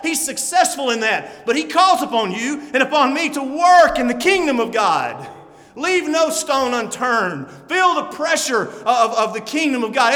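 rally the faithful to work; exhorting sermon. Acted. Exhorts the congregation.

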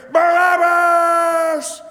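high, slow, stretched-out mob shout; crowd screaming. Retold or imitated. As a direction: cry the name out like a mob. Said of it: "first you hear".

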